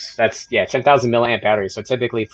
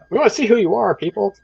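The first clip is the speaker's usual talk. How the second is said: playful high call; 11 semitones higher than usual. Real, not acted. Calling out to viewers.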